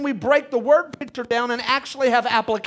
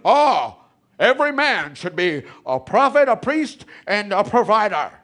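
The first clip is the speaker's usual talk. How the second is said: mock preachy voice; 4 semitones lower than usual.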